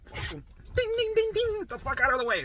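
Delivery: high-pitched mock panic on the bike; panicked freak-out. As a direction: squeal it like you're about to crash. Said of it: "You're gonna be a little bit".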